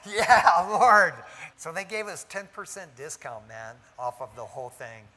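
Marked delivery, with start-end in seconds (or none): 0.0-1.1 loud mock cheer with a laugh; exultant cry. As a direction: act out a jubilant, laughing outburst. Acting out his relieved answer to God.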